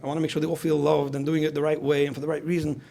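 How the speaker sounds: normal talking voice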